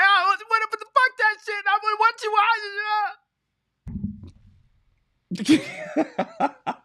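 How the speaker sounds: high, squealing imitation of a whiner; mock whiner. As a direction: squeak it out mocking a complainer. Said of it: "And you're like".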